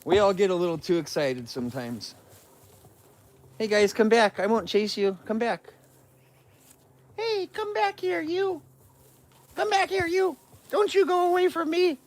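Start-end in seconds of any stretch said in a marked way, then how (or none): 3.6-5.7 raised, soft coaxing voice; coaxing pet call. Real, not acted. Man calling departing bears back, soft high coaxing. 7.2-12.0 tiny, very high falsetto, softly; silly pet-calling voice. Real, not acted. Man to bears walking off, soft falsetto mock scolding.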